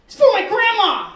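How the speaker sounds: put-on high, loud, child's wail; indignant kid. Boy caught stealing explains it's for grandma, high indignant wail. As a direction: whine it like a caught little boy.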